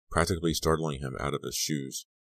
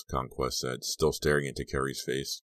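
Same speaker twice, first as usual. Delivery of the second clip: usual speaking voice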